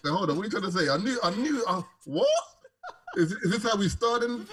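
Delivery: high, loud playful protest; incredulous, half-laughing objection; mock indignation. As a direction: act affronted, pitch leaping up. Mock protest at the host's teasing.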